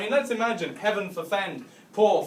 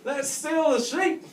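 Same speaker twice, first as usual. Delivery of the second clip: put-on high-pitched, excited drunk agreement; hammed-up drunken cheer, drawn out at the end; drunken enthusiasm; 10 semitones higher, slower than usual. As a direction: leap at the daft idea like a keen drunk. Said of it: "these two were so pissed off their tits".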